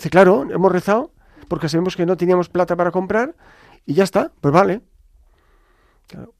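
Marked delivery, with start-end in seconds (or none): 0.1-4.8 put-on raised, loud kid's explanation; matter-of-fact children. Children explaining their prayer to dad, confident raised voices. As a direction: voice children stating the obvious. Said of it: "Entonces salieron los niños".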